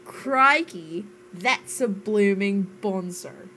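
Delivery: slow, hammed-up Aussie exclamation; mock Australian accent. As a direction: lay the Australian slang on thick, slowly. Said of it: "try to do an Australian accent".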